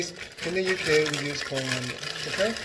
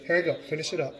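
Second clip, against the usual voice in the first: ordinary delivery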